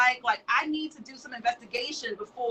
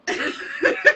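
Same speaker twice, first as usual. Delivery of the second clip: loud laugh; 6 dB louder than usual. Real, not acted. Laughing at own joke.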